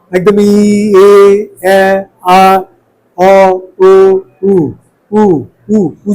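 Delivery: slow run ই এ আ অ ও উ, mouth opening then closing; vowel ladder drill. Shown as a demonstration. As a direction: drill the vowels slowly from closed to open and back. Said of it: "মুখটা আস্তে আস্তে খুলছে".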